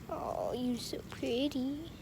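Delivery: soft whisper to a kitten; whispered coo. Real, not acted. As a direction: whisper the compliment to the cat. Cooing at the kitten.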